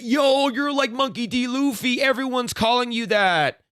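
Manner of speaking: high, excited fanboy gush; mocking interviewer voice. Mock fan gushing to the streamer. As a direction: hype him up like a starstruck fan. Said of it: "and said".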